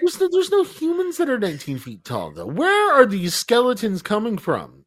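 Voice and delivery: incredulous. Real, not acted. Incredulous about giant skeletons.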